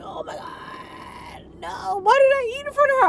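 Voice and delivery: high, gasping horror at herself; whisper-gasp then a high wail of dismay; horrified self-quote. Retold or imitated. Daughter horrified at eating before her mother, gasping. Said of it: "I was like".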